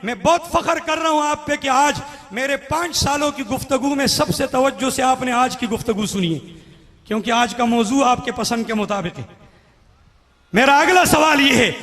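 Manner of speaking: normal talking voice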